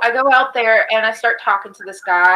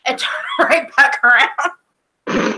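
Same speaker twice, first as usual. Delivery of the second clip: slow, laughing through the words; 4 semitones higher, slower than usual. Real, not acted. Telling friends the punchline, laughing. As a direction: chuckle it out.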